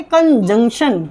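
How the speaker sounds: "कंजंक्शन" as kan-JUNK-shan; correct pronunciation. Shown as a demonstration. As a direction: clear junk in the middle of "कंजंक्शन". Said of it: "इसको बोलेंगे". ("कंजंक्शन" को कन-जंक्शन बोलें)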